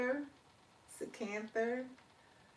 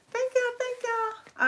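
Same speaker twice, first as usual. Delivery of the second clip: excited thanks; 6 dB louder, slower than usual. Real, not acted.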